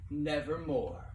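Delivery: ordinary delivery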